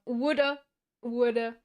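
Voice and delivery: mock British woulda, woulda with a dropped r; lightly imitating a British accent on woulda. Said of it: "your voice is very British".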